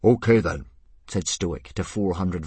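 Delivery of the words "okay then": booming chief. Acted. Stoic addressing four hundred Vikings.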